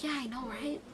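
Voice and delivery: very soft, imitated agreement; hushed reply. Friends answering while police are outside, hushed. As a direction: agree under your breath. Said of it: "so I whispered, I'm like".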